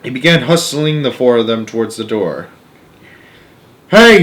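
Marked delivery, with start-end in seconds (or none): 3.9-4.2 loud high yelp; screamed. Acted. Grandma Georgina from bed, a loud high scream. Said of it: "screamed Grandma Georgina".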